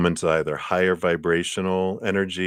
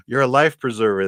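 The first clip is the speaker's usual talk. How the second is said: eager relief; 6 semitones higher, 6 dB louder than usual. Retold or imitated. Drowning person grabs rescuer.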